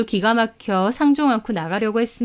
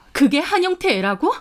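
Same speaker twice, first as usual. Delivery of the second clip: higher, stunned question; incredulous; 6 semitones higher than usual. Acted. Woman hearing of rival's pregnancy, incredulous. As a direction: question it in disbelief.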